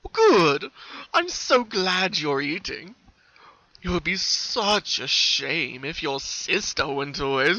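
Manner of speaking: loud, high, slow menace; gloating villain colt. Acted. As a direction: savour her suffering. Villain gloats over captive.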